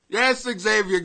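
ordinary delivery

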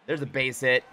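usual speaking voice

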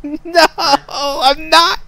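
high, slow laughing reply. Real, not acted. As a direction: speak through the laughter. Laughing after death.

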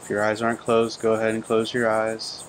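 calm, even meditation voice with long pauses; unhurried phrases, level in pitch, leaving long silences between them; guided meditation. Real, not acted. Teacher guiding listeners to breathe and turn within, calm meditation delivery.

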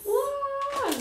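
high amazed wow; surprised. Real, not acted. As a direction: gasp it out in wonder. Reacting to strange snack.

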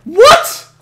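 very high, disbelieving yelp; shocked what. Real, not acted. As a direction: squeak it in disbelief. Killed unexpectedly.

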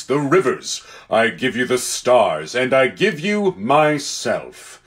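measured, stately proclamation; Aslan, solemn benefactor. Acted. Lion charging his new talking beasts, solemn and deliberate. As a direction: pronounce each gift with weight.

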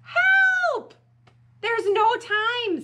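very high, loud yell; hammed-up urgent alarm; panicked shout. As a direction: cry out in an emergency. Panicked friends call for help.

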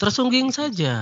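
tersungging saja lifted way up in pitch; tersungging said high and teasing; punning high pitch. Shown as a demonstration. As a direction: sing out tersungging as a playful pun. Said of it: "Jangan tersinggung".

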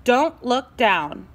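slow, drawn-out caution; gentle slow warning. Acted. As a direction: stretch it out, careful. Warning Pencil on the ledge.

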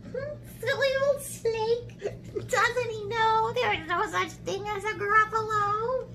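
high-pitched mouse voice; amused little mouse. Acted. Mouse to itself after fooling snake, high and smug. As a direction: scoff lightly in a high mouse squeak.

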